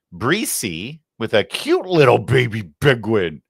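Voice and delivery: a lightly put-on cutesy voice, slow; a hint of a cartoon baby voice; silly cute voice. Host reads a viewer's name in a lightly silly voice. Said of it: "I don't know where that voice came from".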